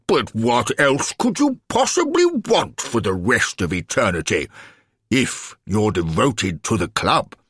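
high, incredulous official's voice, easing down; pompous alderman, baffled. Acted. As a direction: grandly question such ingratitude.

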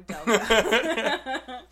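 lachend en luider gezegd; laughing. Real, not acted. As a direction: praat door de lach heen. Laughing over Delco videos.

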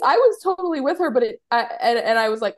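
usual speaking voice

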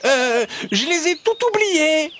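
hesitant, very high fox voice; stall, then blurt it out squeakily; high-pitched sheepish excuse; flustered fox. A narrator's reading. Fox admits forgetting, trapped friend waiting.

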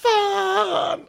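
high, crying-out disgust. Real, not acted. Host reacting to the sauna fart story, high disgusted cry. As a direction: squeal it out in revulsion. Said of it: "Usch, usch, usch".

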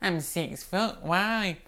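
lightly voiced dad, soft and high; a hint of bemused-dad impression; puzzled dad. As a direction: imitate a wary, puzzled dad answering. Voices puzzled Dad answering the woman.

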